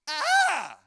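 sudden loud high scream; a short, high shriek of alarm; imitated scream. Preacher imitating a woman screaming in turbulence, loud scream. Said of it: "The woman screamed".

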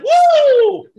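loud high woo in the middle of the line; cheering woo for a fired-up crowd; excited whoop. Real, not acted. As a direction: throw in a quick hype woo. Hype whoop.

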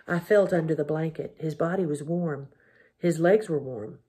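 plain reading voice

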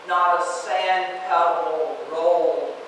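ordinary delivery

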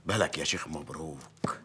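mayor confiding his secret wish to the sheikh; whisper. Acted. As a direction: breathe it like a secret.

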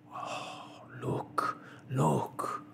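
breath-led, half-whispered excitement; hushed, urgent exclamation; excited. A narrator's reading. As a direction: call attention to a sudden sighting. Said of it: "said the Canadian excitedly".

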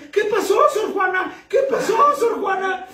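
high-pitched mother superior calling out; alarmed shouting. Acted. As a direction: shout it urgently across the convent. Mother superior runs to screaming nun.

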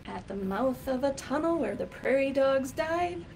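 lively, sing-song picture-book reading; reading to children. Acted. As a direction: read it out like storytime for a class.

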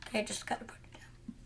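quick whisper to self; hushed quick whisper. Real, not acted. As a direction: whisper it under your breath. Mutters to self.

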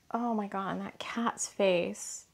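ordinary talk

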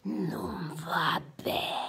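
slow low whisper; hushed stern disappointment; whispered lion master. Acted.

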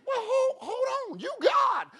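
high, crying-out hold on; shocked protest. Retold or imitated. Preacher retelling his shocked reply to God, high outcry. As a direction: voice jumps up in alarm.

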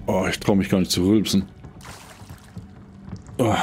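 usual speaking voice

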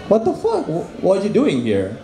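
high, startled Jesus voice; shocked Jesus. Pretend. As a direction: play Jesus aghast at heaven's gate.

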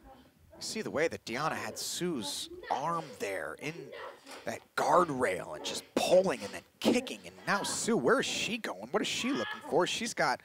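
normal talking voice

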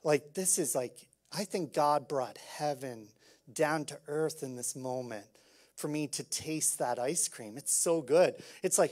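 ordinary delivery

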